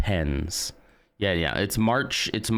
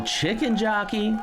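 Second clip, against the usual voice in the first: high, drawn-out mock holler; playful yell. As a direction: yell it high like an excited kid. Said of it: "we're gonna yell".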